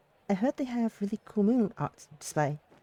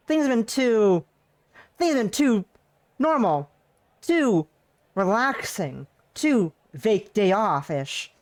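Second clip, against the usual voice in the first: higher and louder anxious rant; nervous excited rambling; worked-up worry; 5 semitones higher, 6 dB louder, slower than usual. Real, not acted. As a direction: fret aloud as tension builds. Anxious rant about the plot.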